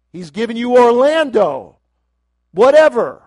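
preaching that builds from strong to a near shout; fiery sermon rising to a cry. Real, not acted. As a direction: whip up the crowd, climbing to a loud, high peak.

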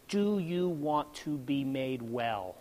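slow, low, soft question; voice of God. Retold or imitated. God questioning a man, solemn and quiet. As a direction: ask it softly and slowly, down low. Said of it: "he asked me a question".

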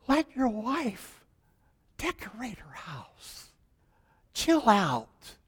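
slow whispered advice; pastor whispering. Retold or imitated. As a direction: lean in and whisper it. Pastor advising softly.

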